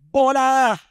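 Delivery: put-on very high, loud child shout; child's shout. Son Jiboy shouting his answer, very high. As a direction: blurt it out eagerly.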